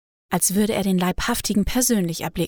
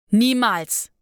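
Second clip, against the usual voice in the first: called out; 2 semitones higher, 3 dB louder than usual. A narrator's reading. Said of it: "rief er".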